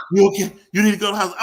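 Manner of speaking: high, loud urgent questions; imitated alarm. Retelling her asking if he needs the hospital, imitated alarm. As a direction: press with exaggerated concern.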